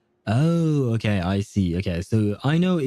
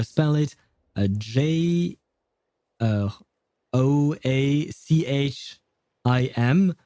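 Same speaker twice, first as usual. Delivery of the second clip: ordinary talk, nothing marked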